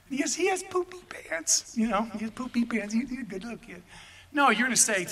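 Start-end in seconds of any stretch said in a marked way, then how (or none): 0.1-3.8 soft mock baby talk, starting high; syrupy doting-parent put-on, kept quiet; cooing parent. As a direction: coo indulgently as if over a baby. Parent cooing over baby-like son.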